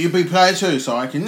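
ordinary talk

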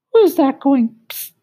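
very high, sing-song cricket voice; a tiny cricket peering down, puzzled and musical. Acted. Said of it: "He had a high musical voice".

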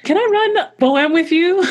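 high-pitched polite ask; imitated eager request to a coach; pleading request. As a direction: ask sweetly, pitched well up. Acts out asking coach a favour.

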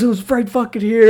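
high, loud indignant yell; retold angry shout. Friend fuming at the empty parking spot.

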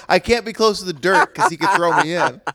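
high, loud imitated quote; mock wrestler voice. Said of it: "The way he reacted is like".

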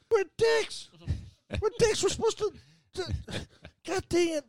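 imitating a sulky kid denied; whiny protest. The speaker whining to Vicky about apologizing, mock whine. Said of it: "And Vicky's like".

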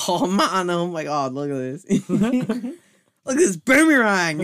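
loud, high hyped-up surfer; extreme hyped kids ad. Acted. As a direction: shout it like a kids ad.

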